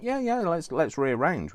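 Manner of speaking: high, loud, over-keen agreement; put-on keen yes; eager mock reply. Acting out an imagined reply.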